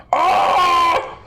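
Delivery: high, loud, panicked giant cry; wounded giant screaming to his guards for help; giant yelling for help. Acted. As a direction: shriek for help like a scared giant. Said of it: "He starts yelling out".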